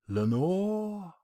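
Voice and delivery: Lenore breathed softly; a soft, hushed call into the dark; whispered name. Acted. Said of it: "This I whispered".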